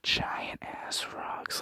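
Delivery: slow, voiceless whisper of giant ass rocks; awed whisper. Retold or imitated. Narrator reacting to huge rocks, slow awed whisper. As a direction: breathe it out in slow awe. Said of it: "And I'm like".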